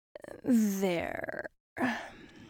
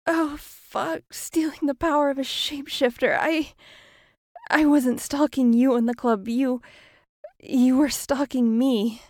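panicked victim; 4 semitones higher, 9 dB louder, faster than usual. Acted. Victim paralyzed, facing doppelganger.

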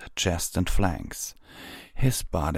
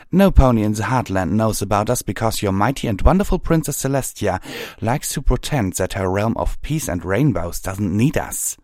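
quick, raised, resentful rant; scornful hothead; 3 semitones higher, 5 dB louder than usual. Acted. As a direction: spit out sarcasm at an outsider. Pyre Valor's angry sarcastic outburst.

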